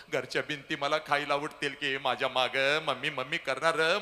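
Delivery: ordinary talk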